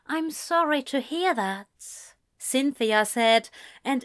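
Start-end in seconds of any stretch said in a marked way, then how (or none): 0.1-2.1 higher-pitched, sympathetic mother; a higher character voice for the mother; lightly voiced mother. A narrator's reading. Cynthia, a mother, voiced in story.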